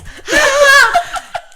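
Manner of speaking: very high, loud mock wail; hammed-up shriek of a hurt kid; imitated scream. Mother showing how her cut son carried on, screaming. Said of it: "he started doing this".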